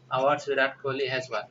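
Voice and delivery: ordinary delivery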